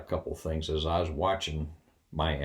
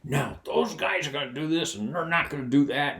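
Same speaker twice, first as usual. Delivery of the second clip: imitating the ranting colonel; 8 semitones higher than usual. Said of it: "And he says".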